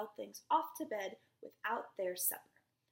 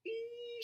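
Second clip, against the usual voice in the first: teeth-baring squeal; 11 semitones higher than usual. Shown as a demonstration. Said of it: "show me your teeth".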